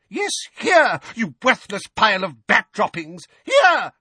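very high, loud shriek. A narrator's reading. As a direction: scream it at him. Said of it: "shrieked Missus Figg".

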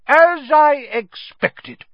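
loud high gloating; Aunt Marge, smug. A narrator's reading. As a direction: savour the insult.

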